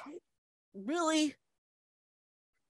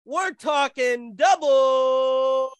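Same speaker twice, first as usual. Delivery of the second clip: announcer intro; 5 semitones higher, slower than usual. Acted. Announcer-style guest introduction.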